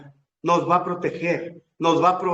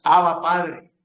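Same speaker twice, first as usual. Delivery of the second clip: loud, fervent invocation; crying out to God; 2 semitones higher, 6 dB louder than usual. Acted. People calling to the Father on his throne, loud crying out. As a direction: raise the voice to call on the Father. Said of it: "y clamaremos".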